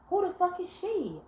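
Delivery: high, sharp mock outburst; hammed-up jealous shriek; mock jealous girlfriend. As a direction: play the jealous woman who jumps to conclusions. Mocking jealous women's outburst.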